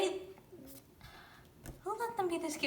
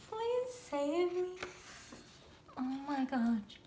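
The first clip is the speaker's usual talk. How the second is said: usual speaking voice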